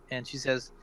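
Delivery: ordinary delivery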